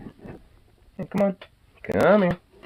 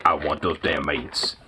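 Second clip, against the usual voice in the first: much lower, softer put-on dog voice; dog's greedy voice; 13 semitones lower, 5 dB quieter than usual. Owner voices the dog wanting the meats, low and greedy. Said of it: "It's just like".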